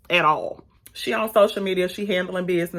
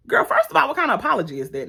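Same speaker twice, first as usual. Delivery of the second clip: indignant; 7 semitones higher, faster than usual. Acted. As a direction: snap back at her. Retort to character's apology.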